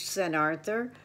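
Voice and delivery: usual speaking voice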